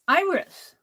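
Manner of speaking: triumphant recall; finally remembering the plant. Real, not acted. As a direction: call out the word in delight. Remembering the word.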